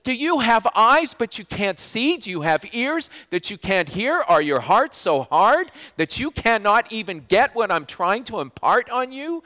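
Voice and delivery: trying to wake the disciples up; impassioned Jesus rebuke. Retold or imitated. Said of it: "He says".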